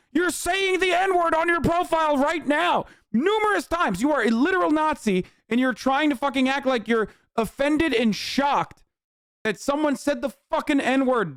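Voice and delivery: high, heated tirade at a troll; furious rant. Real, not acted. Raging at the Groyper account, high-pitched angry rant.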